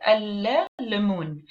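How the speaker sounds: very slow "Al-lemun" as al-le, then lemun; stretched wrong "Al-lemun", article sounded in full; article pronounced separately. Shown as a demonstration. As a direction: break "Al-lemun" apart to expose the extra L. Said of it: "If I wanna hear, um, the definite article too".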